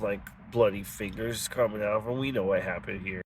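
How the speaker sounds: hushed voice telling the audience about the lettuce cover-up; conspiratorial hush. Acted. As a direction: lean in and murmur like you know the secret.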